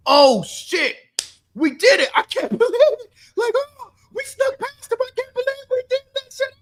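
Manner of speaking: mock overjoyed players squealing in disbelief. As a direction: shriek like you can't believe you got away with it. Acting out Falcons players' wild celebration.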